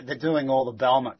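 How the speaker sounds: ordinary talk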